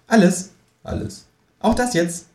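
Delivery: kangaroo voice. Acted. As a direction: confront him slyly.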